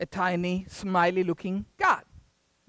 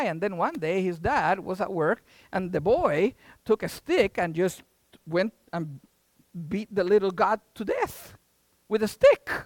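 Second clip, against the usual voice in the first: ordinary delivery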